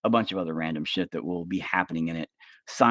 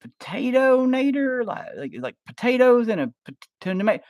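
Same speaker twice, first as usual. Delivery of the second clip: confused friend; 3 dB louder than usual. Pretend. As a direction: fumble the words in a silly high voice. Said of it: "You're like".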